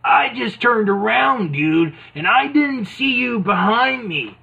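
mock tough-guy excuse; Hulk Hogan. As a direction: explain it like a clueless jock. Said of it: "he's like".

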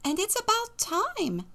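put-on very high old woman voice; high-pitched granny; chuckling old woman. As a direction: pipe up as an amused old lady. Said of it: "chuckled the old woman".

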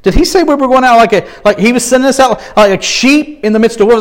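half-panicked, checking what he just heard; a touch of nervous disbelief; anxious disciples. Pretend. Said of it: "if the disciples were".

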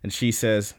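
ordinary talk

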